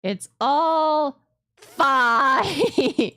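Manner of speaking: slow, wailing "it's all fine"; mock crying. Sarcastic meltdown over unsaved settings.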